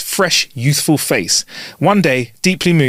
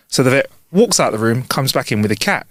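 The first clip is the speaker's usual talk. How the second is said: usual speaking voice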